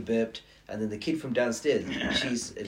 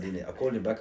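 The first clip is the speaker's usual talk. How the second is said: normal talking voice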